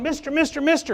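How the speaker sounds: high-pitched, repeated pestering call; nagging frog. Acted. Frog nagging Bob from inside his pocket, high and insistent. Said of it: "the frog keeps going".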